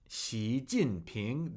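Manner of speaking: Xi Jinping very slow and low, each syllable apart; pronunciation demonstration. Shown as a demonstration. Teacher showing students a leader's name, Xi Jinping slowly and deliberately. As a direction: model Xi Jinping syllable by syllable, rising on the last. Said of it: "That is how you say his name".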